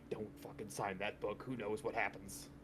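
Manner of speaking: hushed aside to the group; urgent whisper. Acted. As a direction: warn them under your breath. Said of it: "And then whisper, like".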